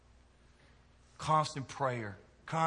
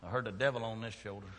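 ordinary talk

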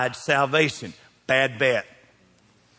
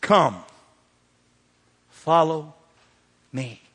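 COME, FOLLOW, ME each set apart by long pauses; deliberate pauses; much slower than usual. Acted. Jesus calling people as he hits town, slow spaced invitation. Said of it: "He hit town and did this".

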